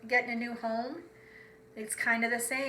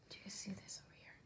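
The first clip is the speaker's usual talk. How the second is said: put-on soft secret whisper to the kids; hushed aside about the hidden crab; 3 semitones lower, 20 dB quieter, much faster than usual. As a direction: lean in and whisper.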